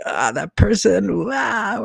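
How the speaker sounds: high, drawn-out cry of mock aversion; mock loathing. Imitating people who dislike a polarizing person, exaggerated loathing. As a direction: wail it slowly as if you can't stand them. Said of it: "not love them. Just like".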